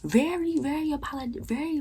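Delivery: ordinary talk, nothing marked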